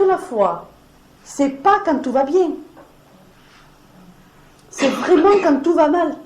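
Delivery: high and loud, pressing the point; fervent preacher's cry; impassioned preaching peak. Real, not acted. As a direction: raise the voice to drive it home. Preaching climax on faith.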